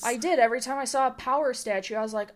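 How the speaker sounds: ordinary talk, nothing marked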